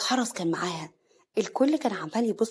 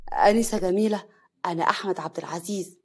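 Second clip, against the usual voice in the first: usual speaking voice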